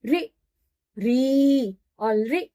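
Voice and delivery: री drawn long, then रि slowly and short; slow contrast. Shown as a demonstration. Teacher slowly contrasting री and रि for students. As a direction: stretch each syllable out carefully. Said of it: "ह्रस्व ही होती है".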